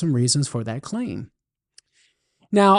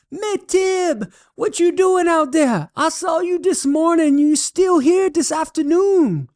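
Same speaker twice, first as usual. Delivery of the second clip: much higher Cajun voice, puzzled call; Cajun holler; 13 semitones higher than usual. Acted. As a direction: call out across the field in a high Cajun voice. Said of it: "Boudreaux hollers back".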